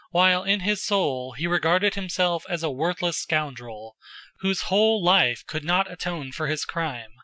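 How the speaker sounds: usual speaking voice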